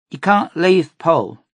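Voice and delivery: put-on high, slow girl's plea; a little girl begging him; pleading girl. A narrator's reading. Child pleading.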